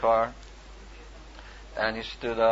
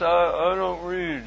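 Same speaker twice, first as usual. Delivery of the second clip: mild, slowed imitation of a dim man; slow dull voice; 5 semitones higher, 5 dB louder, slower than usual. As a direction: drag the words a little. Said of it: "He was a little, you know, slow".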